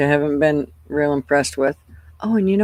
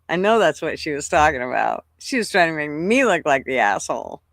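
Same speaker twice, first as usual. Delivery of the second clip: ordinary talk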